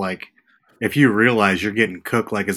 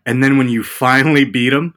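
usual speaking voice

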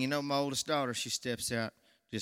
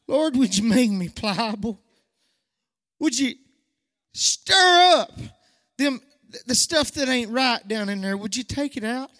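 high, loud pleading prayer, peaking on stir up; preaching at full force in prayer; impassioned prayer; 11 semitones higher, 10 dB louder than usual. Acted. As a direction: cry out to God to change him.

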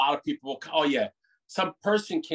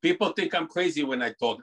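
ordinary talk, nothing marked

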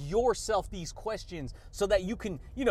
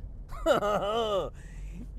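long string of quick ha-has; chuckling laugh. Real, not acted. Podcaster hyping listeners on confidence, chuckling laugh. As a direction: let a rippling chuckle run out.

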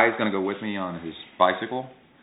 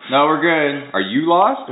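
cheeky comeback; 7 semitones higher, 7 dB louder, slower than usual. Retold or imitated. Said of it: "remember, I go".

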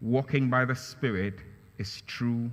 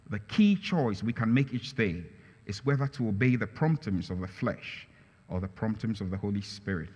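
normal talking voice

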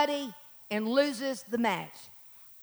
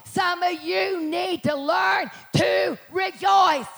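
loud, slow, raised preaching shout; full-force preaching; 7 semitones higher, 7 dB louder, much slower than usual. Real, not acted. Preacher urging the church to rejoice, full-force preaching. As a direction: drive each phrase home to the congregation.

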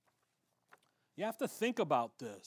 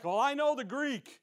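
higher, louder smug boast; mock boast; 6 semitones higher, 6 dB louder than usual. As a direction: show off his Greek, mockingly. Imitating the boastful guy.

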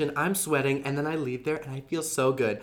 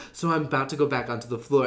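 normal talking voice

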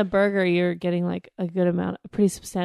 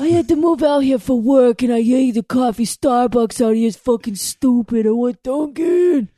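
put-on loud East Coast accent; 3 semitones higher, 5 dB louder than usual. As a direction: homesick East Coast local griping. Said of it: "let her go".

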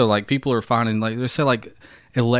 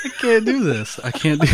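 giggling; 12 semitones higher than usual. Real, not acted. Can't hold straight face.

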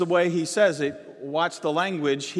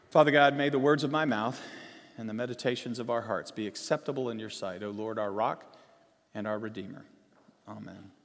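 soft, hushed prayer voice; 7 semitones lower, 7 dB quieter than usual. Real, not acted. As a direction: pray quietly, reverent and low. Leads a closing prayer.

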